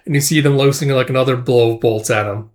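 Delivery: plain reading voice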